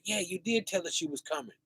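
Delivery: soft, quick murmured answer; hushed reply. Acted. Fellow thieves answer Christ in a quick hushed murmur. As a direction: answer back fast under your breath.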